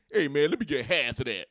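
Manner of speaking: loud mock TV-pundit plea; Shannon Sharpe. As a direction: ham up the TV analyst begging for food. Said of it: "sounding like Shannon Sharpe".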